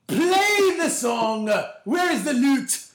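high, loud royal command; a pompous king summoning his minstrel; hammy stage actor. Acted. Calls for the lute, mock royal.

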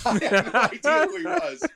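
high, loud burst of laughing speech. Real, not acted. Actor confessing his blunder to the interviewer, laughing. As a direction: laugh through the words at your own blunder. Laughing at own embarrassing mix-up.

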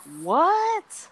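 high, surprised what; startled disbelief, pitched high; mock surprise. Surprised civilian quoted.

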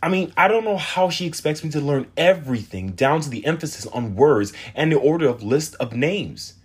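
put-on a fed-up girl venting; complaining teen girl. Teen girl voiced in story.

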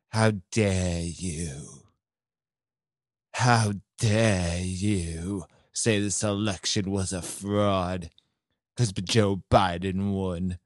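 lightly mocking, indignant officials; mock disdain. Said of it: "they're just disgusted by them".

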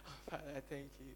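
very soft, quick, low murmur; mock muted praise, hurried and barely voiced; half-hearted thanks. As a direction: mumble a token prayer of thanks. Muted thanks mocked after loud prayer.

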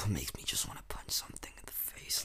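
fast low whisper; guilty whispered aside; whispered confession. Real, not acted. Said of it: "I shouldn't have said that".

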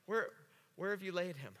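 soft, low, halting question; nearly speechless. Acted. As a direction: act lost for words, faltering on the first word. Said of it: "just kind of speechless".

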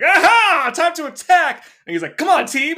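loud, high battle cry; heroic shout. Retold or imitated. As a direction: yell it like a charging hero.

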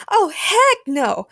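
high, loud, drawn-out outburst; shouted refusal. A narrator's reading. Carol jumps up at being called coward, shouting. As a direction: shout it slowly in outrage. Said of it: "Carol shouted as she stood up".

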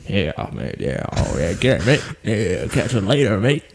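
laid-back cowboy, half-hearted; lazy mock American accent. Friend performing an American accent for the podcast poll, lazy mock American accent. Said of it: "a good American accent".

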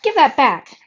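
loud, high demand; angry shout. A narrator's reading. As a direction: shout after a thief. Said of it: "shouted Jack".

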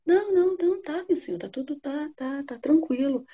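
nervous denial. Acted. The frightened young wife lying to Bluebeard, high and fading. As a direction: stammer out a cover-up, fading.

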